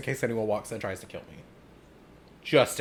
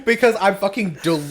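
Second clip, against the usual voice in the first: loud outburst; 8 semitones higher, 7 dB louder than usual. Real, not acted. Exclaims delirium.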